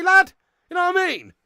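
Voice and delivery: high, loud rhetorical demand; exasperated shout. Real, not acted. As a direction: raise the voice in exasperation. Exasperated rant peaks at end.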